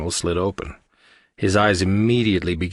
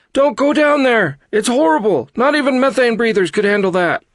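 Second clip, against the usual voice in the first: very high, loud shriek; horrified, high-pitched crew member; panicked warning; 7 dB louder than usual. A narrator's reading. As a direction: scream a warning while fleeing. Fleeing crew warning Nick.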